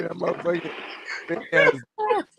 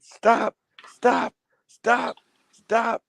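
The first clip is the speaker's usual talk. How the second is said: higher, louder repeated stop; retold yelling stop; 8 semitones higher, 3 dB louder, much slower than usual. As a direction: call the dog off in a panic. Dog biting him, retold.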